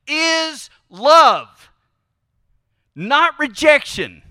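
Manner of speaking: full-force preacher's peak; preaching shout. Real, not acted. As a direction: proclaim it to the back row. Preacher's climax.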